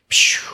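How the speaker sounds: short breathy pshh of an arrow hitting; arrow whoosh sound. Retold or imitated. Telling a friend about the game's one-shot arrow, a quick pshh sound. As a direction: make the sound of the arrow striking.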